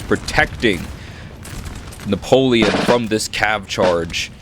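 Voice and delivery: ordinary talk, nothing marked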